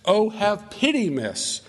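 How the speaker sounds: high, drawn-out pleading that falls away on "miss"; acted-out wail of protest; pleading drunkard. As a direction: beg desperately through withdrawal pains. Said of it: "going through, uh, some withdrawal pains here, and he says".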